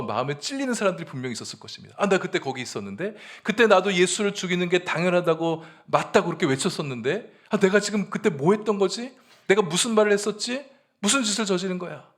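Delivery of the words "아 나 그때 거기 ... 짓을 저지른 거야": higher, louder, an anguished listener realizing guilt; a conscience-stricken man, voiced in earnest; stung conscience. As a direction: cry out as someone who suddenly sees what they did. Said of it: "이런 마음에 찔림이 오는".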